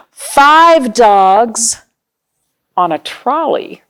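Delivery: gasp, then a bright surprised reveal; mock surprise. Reading to children, a playful gasp of mock surprise. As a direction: act amazed at the new page. Gasp before the next page.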